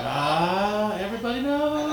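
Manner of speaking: high, laughing groan of defeat; mock dismay. Son seeing his parents arrive, laughing mock despair. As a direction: wail it out through laughter.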